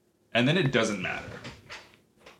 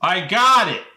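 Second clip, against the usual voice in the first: high, loud insistent shout; real raised-voice outburst; yelling; 8 semitones higher, 9 dB louder than usual. Real, not acted. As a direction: belt it out to claim your turn. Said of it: "why you're yelling at me".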